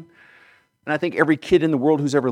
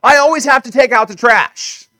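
put-on whiny complaining kid; 9 semitones higher, 10 dB louder than usual. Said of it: "And they go".

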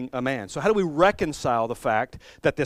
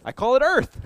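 louder, higher proud announcement; proud reveal; 10 semitones higher, 6 dB louder than usual. Pretend. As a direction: show off your masterpiece. Jesus proudly names his work.

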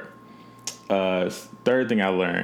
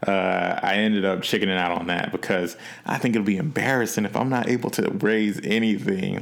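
normal talking voice

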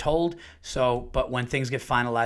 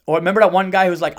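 usual speaking voice

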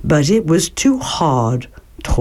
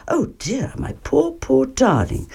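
softer, higher, with a laugh; Mother Bear soothes her baby, soft and higher; comforting mother; 8 semitones higher, 4 dB quieter than usual. Acted. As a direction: coo over the baby. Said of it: "said Mother Bear".

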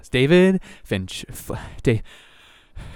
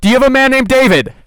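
calling out; 12 semitones higher, 8 dB louder, faster than usual. Real, not acted. Calling to someone far off.

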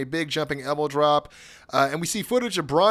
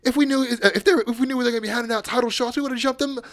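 higher, quick mock British protest; mock British wrestlers; 8 semitones higher, faster than usual. Host voicing the GYV in a light British accent. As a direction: toss it off like a cheeky Brit. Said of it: "they're like".

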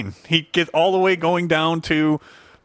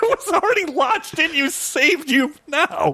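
doctor voice; 8 semitones higher than usual. Retold or imitated. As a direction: play the doctor high and marvelling. Quoting the doctor's line.

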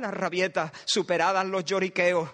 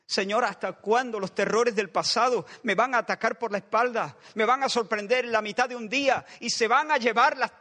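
impassioned prayer; 4 semitones higher than usual. Acted. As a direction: cry out to God, pressing and urgent. Preacher voices an anguished prayer. (oración ferviente y suplicante, más aguda y fuerte)

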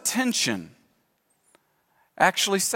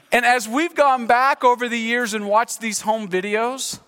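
normal talking voice

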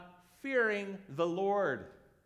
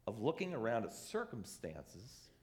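ordinary talk, nothing marked

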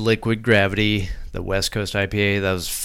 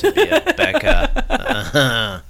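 laughing, high and stretched; much slower than usual. Real, not acted. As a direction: giggle through the words. Laughing through teasing line.

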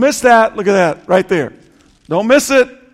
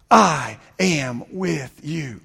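much lower and softer, very slow; hushed comfort; 7 semitones lower, 7 dB quieter, slower than usual. Acted. Pastor speaking Jesus' promise to listeners, hushed slow comfort. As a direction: breathe it out like a quiet promise.

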